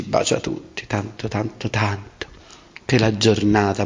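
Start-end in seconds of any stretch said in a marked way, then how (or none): 0.0-2.3 whispering. Acted. Fading whisper.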